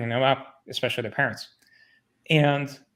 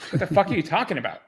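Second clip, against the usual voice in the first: raised, heated pushback, higher and louder; exasperated retort; 6 semitones higher, 3 dB louder than usual. Retold or imitated. Retelling arguing with his boss, exasperated and raised. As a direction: tell the boss off, half-laughing in disbelief.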